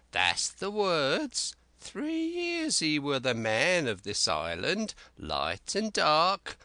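high, slow, cracked voice of a wild marooned sailor; Ben Gunn. Acted. Marooned Ben Gunn eagerly pleading with young Jim, high and slow.

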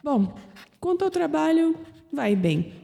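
normal talking voice

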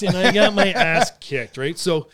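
usual speaking voice

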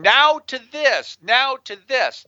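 drawn-out, high-pitched mock yell. Guest imitating the TV host yelling at his producer, mock yell. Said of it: "He's yelling".

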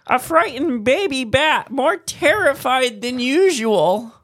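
high, slow, terrified squeak; frightened baby bat voice. Shown as a demonstration. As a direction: play a tiny scared bat. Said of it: "you sound like a frightened".

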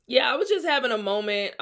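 ordinary talk, nothing marked

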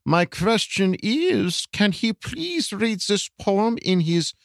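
very slow, high put-on voice; high-pitched, stretched-out mock reading; put-on question reader. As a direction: read the listener's question in a high, drawn-out character voice. Reads listener's question in put-on voice.

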